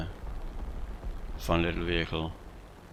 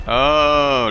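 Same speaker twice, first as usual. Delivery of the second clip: a sudden startled "Oh", louder and higher; surprised oh; 6 semitones higher, 9 dB louder than usual. Real, not acted. Gamer reacting to an exploding target, startled. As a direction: caught off guard by the blast.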